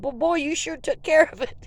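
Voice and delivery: a hint of a drawling dad; lightly voiced amused father. Dad retold, different voice.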